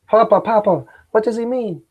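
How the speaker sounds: put-on high, frightened child; little kid asking his papa; child's voice.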